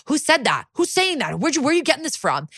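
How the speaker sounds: high, quick mock indignation; imitated freak-out. Detective rattled by an accusation in interrogation, imitated freak-out. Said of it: "Amaro starts to freak out".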